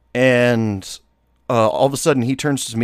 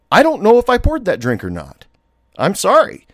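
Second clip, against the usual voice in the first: higher, a bit louder fake apology; feigned innocence; 9 semitones higher, 4 dB louder than usual. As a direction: act innocent, voice pushed up and sweet. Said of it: "I kept playing the card".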